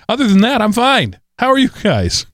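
high, perky sing-out; mock-cheerful. Exhausted host turning to his co-hosts, mock-cheerful. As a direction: leap up high as if all is well.

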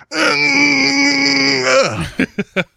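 long, high strained grunt breaking off into a laugh; straining effort groan. Shown as a demonstration. Host showing listeners how chin-up training goes, straining groan. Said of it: "Mostly like this".

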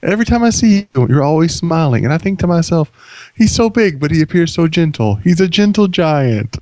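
high, lilting sing-song, slowing at the end; melodic voice. Shown as a demonstration. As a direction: croon it sweetly, high and gentle. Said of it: "just like this".

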